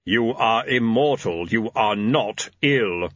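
slow, emphatic voice of Death. Acted. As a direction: pronounce each word gravely and slowly.

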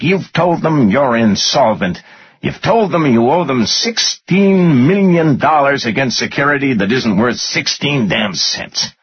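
high, emphatic exasperated rant; angry tirade. A narrator's reading. Finance guy telling his boss he's ruined, angry rant. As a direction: hammer the facts home in anger.

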